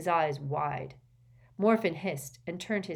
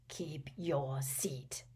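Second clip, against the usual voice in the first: slow, softer whispered warning; 6 dB quieter, much slower than usual. Acted. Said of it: "said his father warningly".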